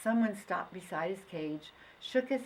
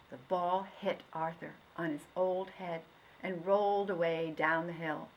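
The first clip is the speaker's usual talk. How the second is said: ordinary delivery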